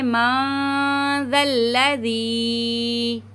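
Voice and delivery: मा ज़ल्लज़ी slow, with the nasal hum held; मा ज़ल्लज़ी recited slowly with a long nasal hum; nasal ghunna. Shown as a demonstration. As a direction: carry the sound through the nose on मा ज़ल्लज़ी. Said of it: "नाक में आवाज़ लेके जाएंगे नून की".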